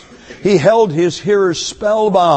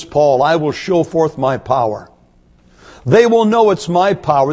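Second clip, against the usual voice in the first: usual speaking voice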